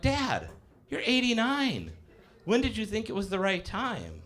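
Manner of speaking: high, disbelieving reply to dad; incredulous. Retold or imitated. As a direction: son to his old dad, high and incredulous.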